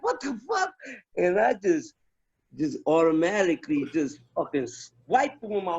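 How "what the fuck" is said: much higher, startled what the fuck; retold shock. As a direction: react as if a gun just got pulled on you. Shocked when gun drawn.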